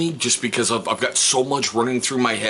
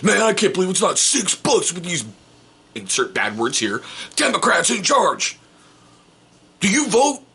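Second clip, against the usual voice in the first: mock political rant; 7 semitones higher than usual. Angry stranger ranting politics.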